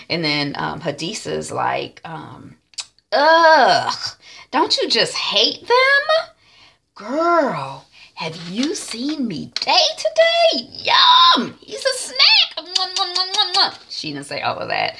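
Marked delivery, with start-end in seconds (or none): 3.1-6.3 loud groan into high, drawn-out whine; catty, hammed-up loathing, stretched slowly; exaggerated disgust. Hadisa disgusted by office rivals. 6.9-13.8 high, drawn-out squeals and kissy noises; hammed-up lusty swoon over a crush; swooning gush. Hadisa drools over Me Day.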